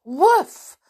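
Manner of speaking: loud high single woof; one stubborn bark; obstinate bark. Acted. Timmy pestering his mistress, loud obstinate bark. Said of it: "said Timmy obstinately".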